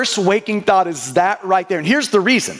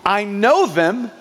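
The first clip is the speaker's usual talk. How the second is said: slow, deliberate verse recital; slow memory-verse reading; slow recitation; slower than usual. Shown as a demonstration. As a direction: lay each word down slowly. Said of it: "Can you say that with me?".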